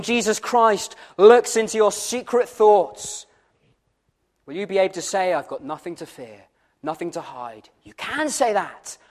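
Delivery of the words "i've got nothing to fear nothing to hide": very low, soft quoted answer; quiet imagined confession. Acted. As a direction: say it hushed, as a private answer to God. Voices the listener's answer before God.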